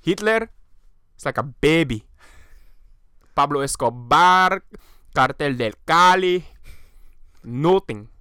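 hammed-up Venezuelan rant; Venezuelan accent. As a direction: Venezuelan accent, listing villains with gusto. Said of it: "really playing in character".

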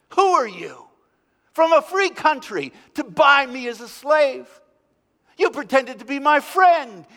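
high, loud, seething rage; furious outburst. A narrator's reading. Freed slave raging at the Englishman, loud and high. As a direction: spit the words at him like a beast. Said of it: "spat at the Englishman".

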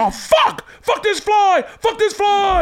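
very high screamed cursing; screaming stand-up rage; Sam Kinison. Acted. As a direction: shriek it in hammed-up outrage. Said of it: "I'd read it like, um, Sam Kinison".

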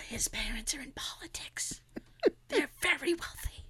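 breathy gossip whisper; stage whisper. Shown as a demonstration. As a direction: whisper the scandal dramatically. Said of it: "exaggerated stage whisper".

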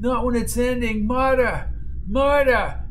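high, desperate cries building on the name; anguished calling out. A narrator's reading. Man calling to Marta as she lies lifeless, anguished cry. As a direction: call out to her, pitch climbing in anguish. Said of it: "he called".

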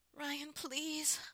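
high, loud desperate plea; beg, pitch and volume climbing; begging. A narrator's reading. Wife pleading with her husband, begging. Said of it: "I begged him".

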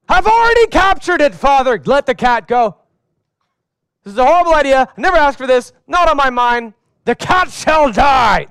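put-on a kid proclaiming a ridiculous oath; pompous son. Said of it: "one of my sons says to me".

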